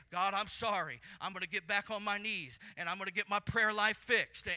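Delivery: fervent, loud vow of repentance; loud repentant prayer. Acted. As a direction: cry out a promise to change. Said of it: "Humility will say".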